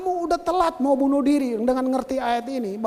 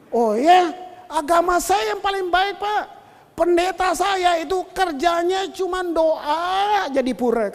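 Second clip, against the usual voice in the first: lightly acted insistent driver, raised voice; boastful taxi driver; 5 semitones higher, 4 dB louder, slower than usual. Taxi driver bragging to a pastor passenger, imitated emphatic boast. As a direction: imitate someone proudly insisting on his religion.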